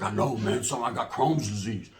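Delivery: low, soft, slow sickly mumble; mock sick cellmate. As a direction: put on a meek, low cellmate voice. Cellmate answers in a put-on voice.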